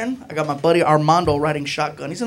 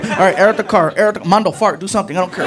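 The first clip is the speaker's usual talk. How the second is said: ordinary talk, nothing marked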